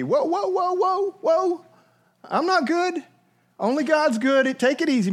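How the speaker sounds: high, flustered "whoa, whoa" brushing off the praise; mock false modesty. Preacher voicing Jesus refusing the title good, in mock flustered modesty. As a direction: pretend to wave off a compliment in a hurry.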